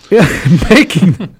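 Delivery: laughing through yeah; chuckling as he answers. Real, not acted. Laughs at cohost's joke.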